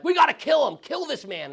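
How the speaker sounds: King David overreacting in a rage; over-the-top outrage. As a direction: demand his death at full tilt. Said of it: "he's actually getting a little over the top".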